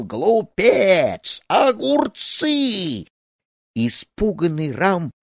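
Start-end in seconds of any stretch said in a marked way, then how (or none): 0.0-3.1 very high, drawn-out cries; stamping, angry shouting. A narrator's reading. Snake charmer stamping his feet at the boy, furious shout. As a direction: wail each word in a towering tantrum. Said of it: "топал ногами и кричал".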